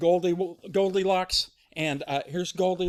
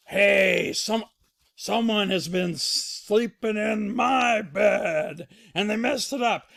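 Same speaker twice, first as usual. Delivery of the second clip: loud, slow, raised bear voice; Papa Bear angry; 5 semitones higher, 6 dB louder, slower than usual. Acted. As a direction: stretch out the outrage loudly. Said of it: "and he says".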